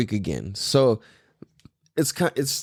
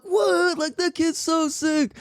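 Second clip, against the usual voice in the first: amazed surfer; slower than usual. Retold or imitated. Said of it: "They were like".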